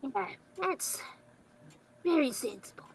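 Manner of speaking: hushed, hesitant near-whisper; faint, halting murmur of realization; dawning recognition. Acted. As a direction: trail off very softly as it sinks in. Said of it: "just like, recognition dawning on Marvin".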